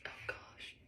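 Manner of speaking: whispered through tears; barely-there breath of despair; crying, worn out. Real, not acted. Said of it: "I can't stop crying".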